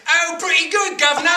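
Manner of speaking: high, loud, hammed-up Cockney; bad Cockney accent. Performer answers office colleagues about his accent work, hammed-up Cockney. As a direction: show off a terrible Cockney accent at full volume. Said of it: "how's your accent work coming along".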